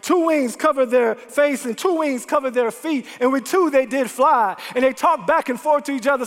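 ordinary talk

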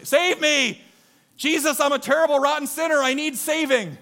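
high, loud cry of confession; acted shouting for rescue; yelled plea. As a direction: holler it out in church. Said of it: "It's kind of an odd thing to yell".

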